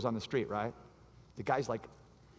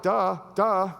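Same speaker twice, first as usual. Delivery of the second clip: mock dumb; 5 semitones higher, 6 dB louder than usual. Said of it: "we're all like".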